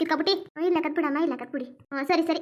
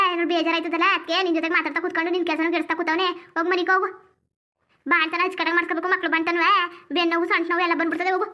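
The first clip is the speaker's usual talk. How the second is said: normal talking voice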